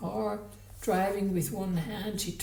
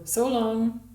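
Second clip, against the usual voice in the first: curt farewell; 4 semitones higher, 8 dB louder than usual. A narrator's reading. She dismisses him curtly.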